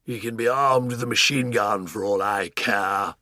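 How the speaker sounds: put-on slow, booming old Duke bellow. Duke dismissing the danger, booming bellow. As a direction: bellow it defiantly. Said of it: "bellowed the Duke".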